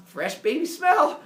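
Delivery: high, loud, drawn-out delight; hammed-up relish. Kronos sniffing the baby, hammed-up high relish. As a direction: savour each word, mock ecstatic.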